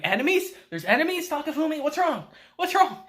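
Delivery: high, urgent comic panic; mock-alarmed uncle. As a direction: barge in with rising alarmed questions.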